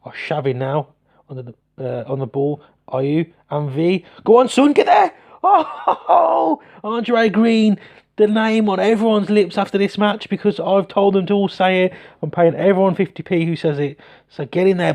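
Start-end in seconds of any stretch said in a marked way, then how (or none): 4.2-9.6 very high, loud cheering shout; excited yell. Real, not acted. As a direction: scream the player on toward goal. Cheering a chance in game.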